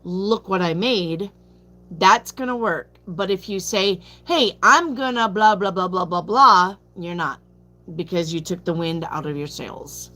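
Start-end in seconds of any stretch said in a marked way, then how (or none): none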